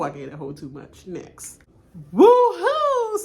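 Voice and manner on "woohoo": high, loud whoop; whooping cheer. Pretend. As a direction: let out a big celebratory woo. Cheers after the vote.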